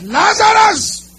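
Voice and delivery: loud, high, drawn-out call; cried out. Acted. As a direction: call the dead man out of the grave. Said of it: "He now cried out".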